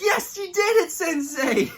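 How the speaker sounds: high, loud cheer; imitating Raph. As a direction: shout it like an elated pupil. Acts out Raph cheering his sensei.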